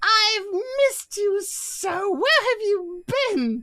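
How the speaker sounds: very high, slow gushing voice; creepy Santa. Acted. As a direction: gush over a long-lost friend. Santa greets the demon.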